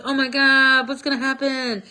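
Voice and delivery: Mordecai in a lightly hammed-up fright; panicked. Mordecai hearing the threat, mock panic. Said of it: "Mordecai's like".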